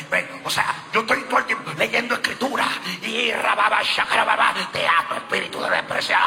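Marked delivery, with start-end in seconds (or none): 3.0-6.2 mock deliverance shout. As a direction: cast out the demon in a hammed-up roar.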